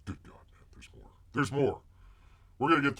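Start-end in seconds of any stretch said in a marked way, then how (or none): 0.1-1.1 breathy whispered groan at the clip; exhausted whisper. Real, not acted. Streamer worn out by the clip, whispering to chat. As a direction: whisper it, worn out, half to yourself.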